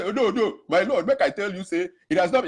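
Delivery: high-pitched mock pleading; flustered protest. Man pleading with the judge, flustered protest.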